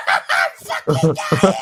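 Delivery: loud, slow, low-pitched mock shout; fake dying shout. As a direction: bellow it like you're dying. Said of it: "you need to be like".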